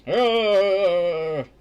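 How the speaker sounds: loud harsh growl of fury; mock furious growl; angry mother's roar. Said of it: "That's probably what it sounded like".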